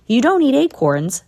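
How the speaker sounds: can't believe what he hears; incredulous. A narrator's reading. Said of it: "said Chai incredulously".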